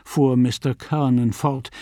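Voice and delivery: usual speaking voice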